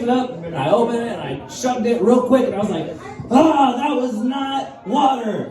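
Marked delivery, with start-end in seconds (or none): 3.3-5.5 laughing realization. Retold or imitated. Realizing he drank vodka.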